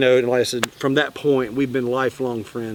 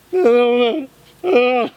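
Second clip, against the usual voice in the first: high, mock-frightened whimper; hammed-up high, helpless protest; imitated panic; 10 semitones higher, 3 dB louder than usual. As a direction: play up someone balking in a panic. Imitating Heather panicking on boat.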